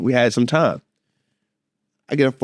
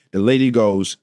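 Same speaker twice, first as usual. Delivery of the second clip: usual speaking voice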